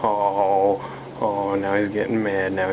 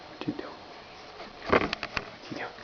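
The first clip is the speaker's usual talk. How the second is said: whispered question to the cat; 11 dB quieter, faster than usual. Real, not acted. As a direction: hush it, curious.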